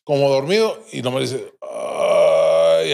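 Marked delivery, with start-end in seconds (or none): 1.6-2.9 long, low groan; drawn-out moan on one low note. Retold or imitated. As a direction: groan low as if half asleep. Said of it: "así como que me quejé".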